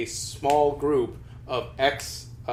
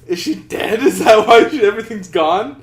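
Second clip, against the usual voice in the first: raised, louder mock alarm breaking into laughter; retold alarmed disbelief; 7 semitones higher, 10 dB louder than usual. Guild members wondering why everything vanished, mock alarm. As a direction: pitch up the panicked questions, half laughing.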